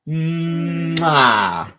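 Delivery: long loud smacking kiss; kiss sound. Real, not acted. Dad kissing his baby, long loud smack. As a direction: give a big smacking kiss.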